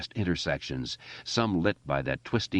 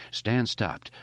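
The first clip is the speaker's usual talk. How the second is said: ordinary delivery, nothing marked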